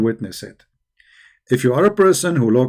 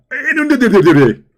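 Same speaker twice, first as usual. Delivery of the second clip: laughing; 6 semitones higher, 4 dB louder than usual. Retold or imitated. Laughing through the mimic.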